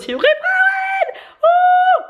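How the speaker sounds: high, slowed squeal into a loud whoop; elated whooping. Real, not acted. Vlogger is high on passing theory test, elated whooping. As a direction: build to a real shriek of joy.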